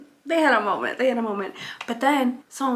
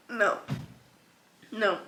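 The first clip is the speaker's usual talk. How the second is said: ordinary talk, nothing marked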